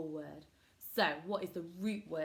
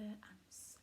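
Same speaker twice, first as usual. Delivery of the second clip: whispering; 16 dB quieter than usual. Shown as a demonstration. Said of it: "whispered sound hint".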